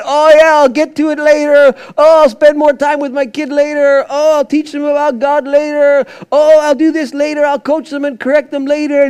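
high, loud mock-lazy dad putting things off; mocking procrastinating dad. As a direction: parody the excuse-making father.

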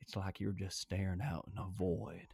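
stare into the void, deep and hushed; Matthew McConaughey as Rusty. Shown as a demonstration. Host trying his Rusty Cohle impression, low and hushed. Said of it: "that almost sounded too low for me".

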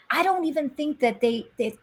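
choked-up confession; near tears. Real, not acted. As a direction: hold back tears. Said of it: "now I'm gonna cry again".

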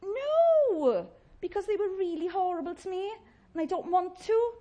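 sulky refusal. Retold or imitated. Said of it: "answering God".

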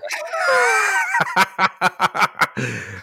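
fou rire criard très aigu; éclate de rire bruyamment; grand éclat de rire. Real, not acted. Big laugh at the caller.

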